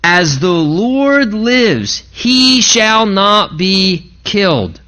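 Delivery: slow, solemn mock-pious oath; drawn out like a sacred vow; Saul's pious vow. Pastor voicing Saul's pious promise to Jonathan, slow and solemn. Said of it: "he says".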